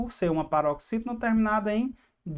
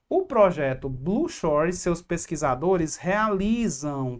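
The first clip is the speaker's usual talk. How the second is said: slow, pointing out "realizam" with the final nasal -am clearly said; teacher stressing the plural; slower than usual. Shown as a demonstration. Teacher showing students the plural verb, slow and deliberate. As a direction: lay it out slowly so the class hears the plural ending of "realizam". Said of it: "cadê o plural?".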